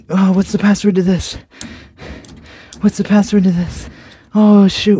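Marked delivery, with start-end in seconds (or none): 4.3-5.0 loud frustrated outburst; sudden loud annoyed curse; frustrated exclamation. Real, not acted. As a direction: blurt it out in annoyance. Forgot password, frustrated.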